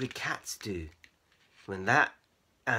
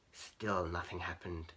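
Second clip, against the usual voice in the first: ordinary delivery, nothing marked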